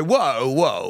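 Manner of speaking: drawn-out whoa with a falling tune; horse language. Shown as a demonstration. Prompting co-host for horse language, drawn-out whoa. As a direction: stretch each whoa as if halting a horse. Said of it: "give me your horse language".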